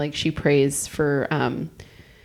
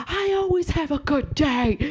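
high, slow mock of a grumpy driver; a driver snapping back through his window, high and mocking; mock angry driver; 9 semitones higher than usual. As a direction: mimic the grouch, pitched up and drawn out. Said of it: "he goes".

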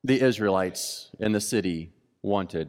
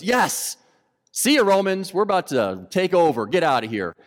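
gleeful zealot; 6 semitones higher, 4 dB louder than usual. Acted. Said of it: "One goes".

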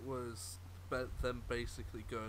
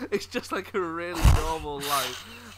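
laughing; 6 semitones higher, 13 dB louder than usual. Real, not acted. Laughing mid-story.

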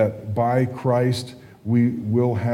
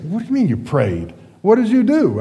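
incredulous; 9 semitones higher, 3 dB louder than usual. Retold or imitated. Incredulous imagined reaction to praying.

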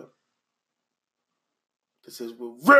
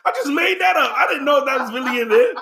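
laughing shout; 7 semitones higher than usual. Real, not acted. Laughing at own joke.

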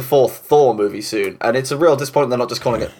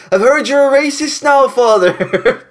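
high squeaky Irish priest voice; Father Ted impression; 12 semitones higher, 4 dB louder, slower than usual. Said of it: "It's when he's like".

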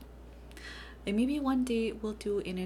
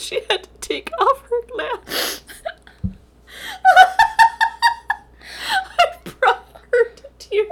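high and loud, wheezing with laughter; laughing; 12 dB louder than usual. Real, not acted. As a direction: talk through tears of laughter. Both collapsing in laughter.

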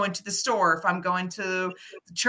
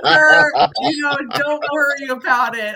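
reassuring; 5 semitones higher, 8 dB louder than usual. Retold or imitated. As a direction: put his mind at ease. Reassuring the gentleman.

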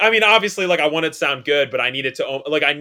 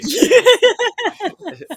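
loud squealing laughter; loud high laugh; 8 dB louder than usual. Real, not acted. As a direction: crack up loudly. Laughing at album joke.

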